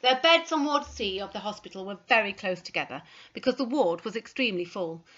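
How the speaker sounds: ordinary delivery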